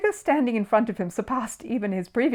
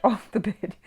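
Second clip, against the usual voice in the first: usual speaking voice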